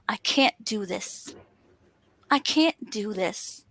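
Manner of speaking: mildly defeated, slow and low; defeated self-talk. Retold or imitated. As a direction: voice your old doubting self, dragging it out. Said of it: "the old [name] used to say".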